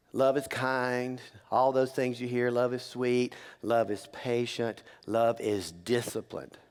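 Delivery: calm reflective sermon voice; gentle preaching. Acted. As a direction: lay each line down tenderly. Preacher softens on love.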